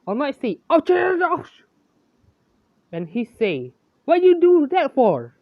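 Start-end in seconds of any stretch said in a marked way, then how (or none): 0.7-1.6 high, loud ouch ouch ouch; All Might yelps after his foot is stomped, high pitched; mock yelps of pain. Stomped-on hero yelping high and loud. 4.0-5.4 high, loud complaint; All Might protests loudly at the kid, pitched high; indignant shout. Acted. Hero protests to Sen after the stomp, high and loud.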